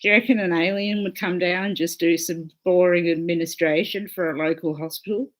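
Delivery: usual speaking voice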